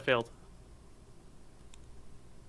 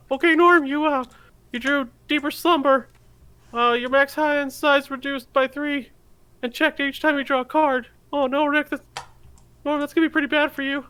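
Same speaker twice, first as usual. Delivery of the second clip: put-on much higher, jittery kid sidekick; whiny, anxious cartoon teen; nervous Morty voice; 7 dB louder than usual. Morty reading the card to Rick, high and fretful.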